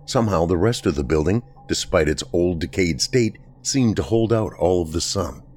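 plain reading voice

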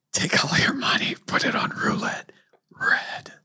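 eerie whispered instruction; ghostly whisper. Retold or imitated. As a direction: phantom whisper. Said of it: "voice repeats".

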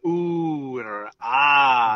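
drawn-out oohing and aahing; slowly ooh and ah as if admiring a view; sightseer's admiring ooh and ah; mock awe. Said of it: "you might hear me, like, go with a".

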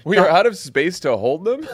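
laughing in disbelief; incredulous laugh. Retold or imitated. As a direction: repeat it like it's absurd. Echoes Trump's line in disbelief.

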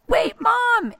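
put-on high, loud child's cry; urgent kid shout. Son in the back seat calling out, high and urgent. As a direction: yelp it like a kid in sudden panic. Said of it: "and he was like".

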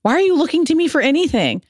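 incredulous self-quote. Retold or imitated. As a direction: squeak it out, baffled. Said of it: "I'm like".

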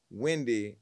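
normal talking voice

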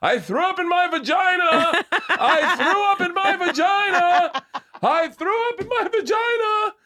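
put-on very high shrieking little-girl voice; top-of-lungs child yell. Young daughter announcing her accident on a plane, high yell. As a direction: imitate a child wailing for the whole cabin. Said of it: "at the top of her lungs is going".

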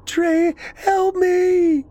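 very high, drawn-out plea; terrified scream. A narrator's reading. Celeste calling Trey to save her, screaming. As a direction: cry out for help, long and high. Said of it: "she screamed".

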